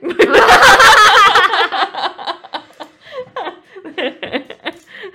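rising squeal of a laugh; high loud laughter. Real, not acted. Laughs at the book remark. (높고 크게 터지는 웃음)

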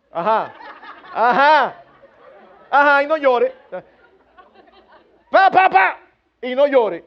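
loud hammed-up parental telling-off; mock scolding. Parent forbidding a crying child to cry, loud mock scolding. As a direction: imitate a parent scolding between smacks.

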